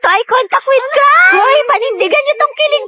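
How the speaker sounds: high, drawn-out teasing shrieks; kilig squealing. Acted. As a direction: squeal over a friend's crush. Friends squeal teasing over crush. (tumitiling kinikilig)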